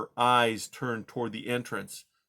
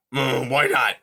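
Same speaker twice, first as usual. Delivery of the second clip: gruff porcupine; 7 dB louder than usual. Acted. Porcupine asks.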